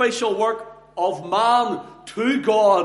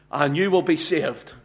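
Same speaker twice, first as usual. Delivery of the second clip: ordinary talk, nothing marked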